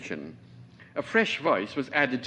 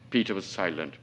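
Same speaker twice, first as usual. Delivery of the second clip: usual speaking voice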